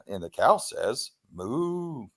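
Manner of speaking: measured announcement, then a long soft moo; talking pull-string toy. Retold or imitated. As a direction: imitate a pull-string animal-sounds toy. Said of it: "remember this toy. You pull a string".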